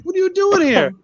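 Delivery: high, overcome voice; tearful dad. Retold or imitated. Dad crying as he hugs his surprise visitor, tearful. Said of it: "He was crying".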